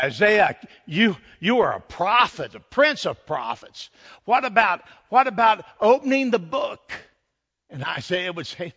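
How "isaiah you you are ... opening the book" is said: high, loud acted pleading; urgent appeal. Preacher as angels begging Isaiah to open the book, urgent appeal. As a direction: press him eagerly, pitch climbing to the end.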